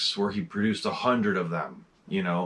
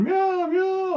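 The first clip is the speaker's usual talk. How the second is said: very high mock cat meows; imitated meows. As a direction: mew twice like a begging cat. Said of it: "He's going".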